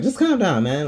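high-pitched mock plea to settle down; exasperated bystander. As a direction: pitch it way up like an onlooker intervening. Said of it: "somebody just come up with a fire extinguisher".

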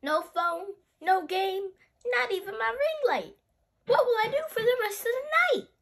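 high, loud, dramatic whine of dismay; dismayed child. Acted. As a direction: wail each loss, building to a frantic question. Child upset by power cut.

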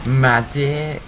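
loud, drawn-out mimicked game call; imitated medic call. Player mimicking a game character's medic call, loud imitation. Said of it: "sounds like he's literally saying".

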